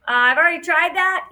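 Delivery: high, loud little drone protest; insistent piping drone voice; impatient drone Fly. Acted.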